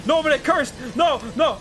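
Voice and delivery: high, loud panicked cry of no; panicked yell. Real, not acted. Streamer yelling in panic as his weapon nearly curses. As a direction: yelp in real alarm as the run nearly dies.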